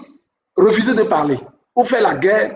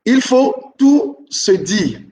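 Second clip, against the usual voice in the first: very slow stress; much slower than usual. Shown as a demonstration. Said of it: "teacher repeats rule slowly".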